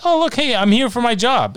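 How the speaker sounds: high-pitched mocking voice; mock cheery arrival.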